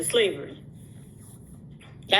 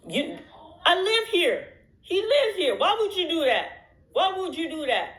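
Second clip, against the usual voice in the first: upset confrontation; 8 semitones higher than usual. Real, not acted. Creator confronting a YouTuber about leaked footage, high indignant. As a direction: call him out, hurt and high. Confronting the person who exposed her.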